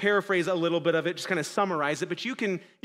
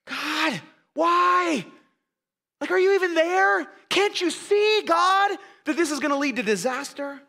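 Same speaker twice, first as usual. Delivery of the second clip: high, loud pleading outcry; a higher, louder anguished prayer; desperate cry to God; 10 semitones higher, 5 dB louder than usual. Retold or imitated. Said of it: "you thought".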